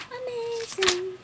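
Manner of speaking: high, slow, gushing amazing; drawn-out amazing. Real, not acted. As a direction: stretch it out, delighted. Showing off decorated planner.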